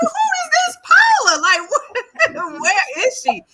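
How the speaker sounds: high, loud, laughing who-is-this; cracking up while asking; laughing curiosity. Retold or imitated. Hearing everyone rave about Paula, loud high laughing question.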